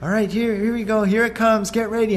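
high, eager wave-catching pep talk; excited dad coaching. Retold or imitated. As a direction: hype her up for the wave. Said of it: "I said".

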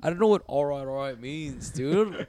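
high-pitched retold protest breaking into laughter; exasperated. Grappler baffled by visitor's all right, laughing exasperation. Acts out own frustrated reply.

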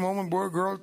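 usual speaking voice

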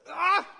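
loud, high "ah" of exasperation; frustrated yell. Retold or imitated. Preacher replaying dismay at being unable to resign, frustrated yell. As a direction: burst out in acted frustration.

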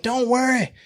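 high, drawling Texan voice imitating the regional rep; Texas twang. Texas rep reassures the fainted new hire, in a high Texan twang. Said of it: "got the twang and everything".

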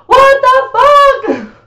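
high, loud, slow drawn-out shriek; horrified scream. Retold or imitated. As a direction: shriek it in horror. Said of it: "and then I was like".

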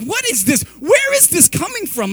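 high, loud upset questioning; acting out losing it at his son; retold angry outburst. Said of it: "I am so upset and so angry".

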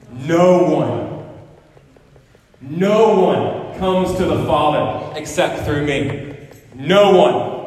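loud, slow pulpit declaration; full-force preaching. Acted. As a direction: thunder it out to the congregation. Quotes Jesus at full force.

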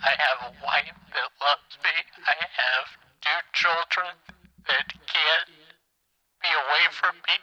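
on the verge of breaking down; fighting back tears while insisting otherwise; very sad. Real, not acted. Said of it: "sounds like you're very sad".

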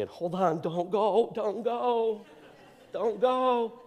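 high, loud pleading don't go; pleading cry. Retold or imitated. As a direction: beg desperately to stay back. Pleading with himself at altar call.

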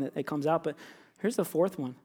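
normal talking voice

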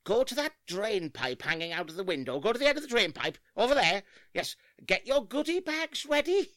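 high sugary letterbox voice; through a mail slot. Acted. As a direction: coax kids through the letterbox. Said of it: "I'm speaking through the letterbox".

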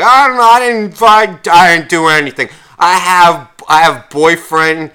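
high, loud whiny protest; mock drunk girl protest. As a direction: ham up a tipsy girl denying everything. Mimicking pissed-off drunk girl.

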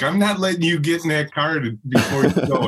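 husband playfully blocking wife at the car; mock insistent. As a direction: plant your feet and refuse to let her go.

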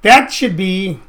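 usual speaking voice

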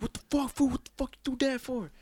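high mock tough-guy yell.

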